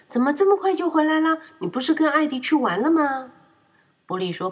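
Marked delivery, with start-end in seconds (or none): 0.1-3.3 high, fast, surprised mother; mother startled he's home early; surprised question. A narrator's reading. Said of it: "惊讶地问". (惊讶地问)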